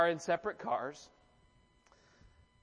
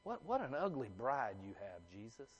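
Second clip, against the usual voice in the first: hushed mock insult; 8 semitones lower, 11 dB quieter than usual. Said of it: "that's what we're saying to Jesus".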